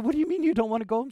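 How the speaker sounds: quick retold mock astonishment; surprised disbelief. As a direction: rush out a mild puzzled question. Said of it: "I said".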